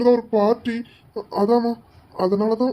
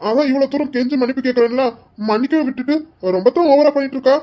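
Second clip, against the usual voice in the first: louder, fast, fed-up complaint; exasperated outburst; 3 semitones higher, 3 dB louder than usual. Acted. Husband fed up with wife's sulking, loud exasperated outburst. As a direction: let the frustration burst out quick. Husband loses patience, snaps.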